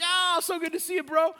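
high, gushing buddy voice; hearty greeting. Retold or imitated. Rich guy welcoming his college buddy, high and effusive. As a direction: gush loudly over an old friend.

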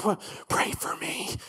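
hushed, desperate whisper; whispered plea. Retold or imitated. Nervous test-taker pleading to mom on the sidewalk, whispered. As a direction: whisper it, barely any voice.

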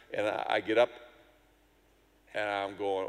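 ordinary talk

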